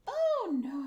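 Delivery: high, loud, breathy gasp of alarm. Real, not acted. Panicked gasp to chat as the piece slips. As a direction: yelp it out in panic.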